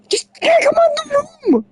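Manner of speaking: very high, cracked plea; squeaky plea. Retold or imitated. Victim begging the killer, squeaky high plea. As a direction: squeal it up in mock desperation.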